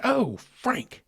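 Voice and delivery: high-pitched startled cry; startled exclamation. Retold or imitated. Repeating to listeners his reaction to the flash, startled cry. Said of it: "it scared me".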